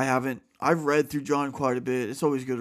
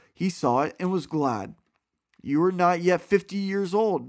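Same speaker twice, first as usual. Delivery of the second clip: clear, louder, steady Bible reading voice; scripture reading; 2 semitones higher, 6 dB louder than usual. A narrator's reading. Reading John aloud to listeners, earnest scripture voice. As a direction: read the passage out with conviction.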